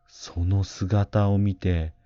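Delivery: usual speaking voice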